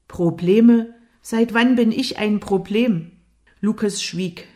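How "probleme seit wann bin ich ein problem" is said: louder, higher, offended questions; indignant outburst. A narrator's reading. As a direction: throw the word back in outrage. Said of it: "Wenn Jimmy diesen Tonfall drauf hatte". (empört und aufbrausend)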